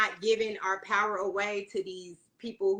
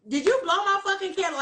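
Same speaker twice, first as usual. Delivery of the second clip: mock angry demand; 7 semitones higher, faster than usual. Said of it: "And I was like".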